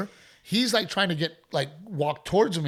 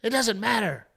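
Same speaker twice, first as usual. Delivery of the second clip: high, dismissive mock voice; mock heckler woman; 7 semitones higher than usual. Heckler woman waving off the comic's excuse, high mock voice. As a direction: brush him off like a stubborn fan. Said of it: "She goes".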